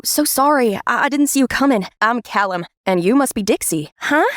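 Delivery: plain reading voice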